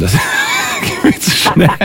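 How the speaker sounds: laughing protest. Real, not acted. Host telling the caller he can't write along, laughing protest.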